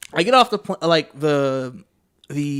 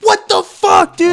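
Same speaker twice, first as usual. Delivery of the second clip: high, loud shout of disbelief; a stranded traveller shouting at the station staff; outraged shout; 8 dB louder, slower than usual. Retold or imitated. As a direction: blurt it out at the cancellation. Bus cancelled again.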